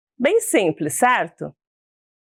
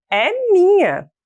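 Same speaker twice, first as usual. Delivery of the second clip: put-on higher-pitched, possessive child's cry; quarrelling little girl; 8 semitones higher than usual. First girl fighting her friend over a doll, quarrelling. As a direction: claim the doll in a raised, high voice. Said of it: "Dizia a primeira".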